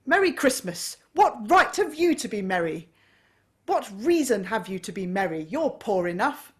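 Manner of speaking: loud, high contemptuous tirade; mock his nephew's cheer with a snarl; scornful scolding. A narrator's reading.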